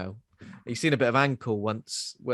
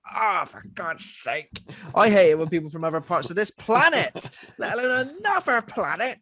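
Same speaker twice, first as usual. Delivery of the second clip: mock grumbling man; 5 semitones higher, 3 dB louder than usual. Voicing the grumpy man.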